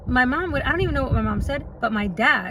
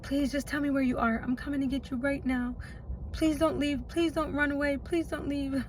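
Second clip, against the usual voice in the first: quiet pleading; 9 dB quieter than usual. Retold or imitated. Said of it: "he pleaded his case".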